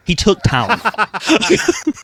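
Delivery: drawn-out giggling reveal of the prank; laughing punchline. Real, not acted. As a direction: savor the punchline through laughter.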